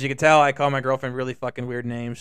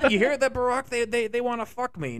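higher, lightly put-on politician voice; mock befuddled president; 8 semitones higher than usual. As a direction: confide as a confused president, pitched up. Impersonates president talking to Barack.

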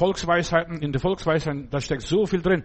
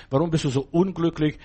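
usual speaking voice

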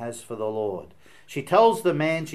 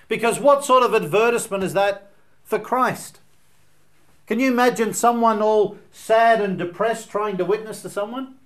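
loud, high, urgent pulpit voice; preaching at full force; 8 semitones higher, 8 dB louder than usual. Acted. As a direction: drive the question home to the congregation. Preacher raises voice to congregation.

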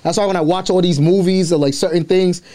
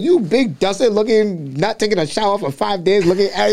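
put-on clown them in an African accent, pitched up; roast in African accent; 6 semitones higher than usual. New African kid roasting gang boys, African-accented mock insult. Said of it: "I still got the accent too".

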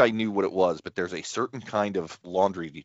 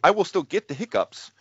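usual speaking voice

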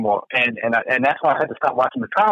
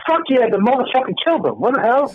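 acted juror outburst; 7 semitones higher than usual. Acting out jury selection.

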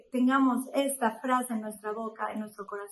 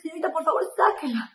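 retold pleading; 4 semitones higher, faster than usual. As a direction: plead with the nurse to pull the needle out. Begging the nurse mid-injection.

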